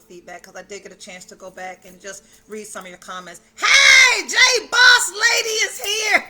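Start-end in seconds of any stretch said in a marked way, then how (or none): 3.5-6.2 very loud, high, slow call of welcome; thrilled shout. Real, not acted. Host spotting her friend join the stream, shouting happily. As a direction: shout for joy as a friend arrives.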